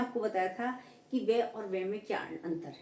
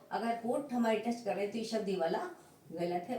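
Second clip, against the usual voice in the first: ordinary talk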